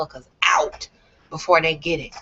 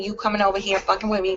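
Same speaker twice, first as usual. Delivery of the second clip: ordinary delivery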